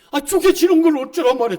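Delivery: cheerful retort. Retold or imitated. Retort to mocking outsiders.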